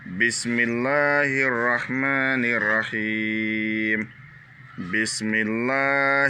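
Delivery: Bismillahir Rahmanir Rahim with the long vowels stretched; slow, lengthened Quran recitation; drawn-out vowels. Shown as a demonstration. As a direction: recite slowly, holding each long syllable. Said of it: "là, on tire un peu".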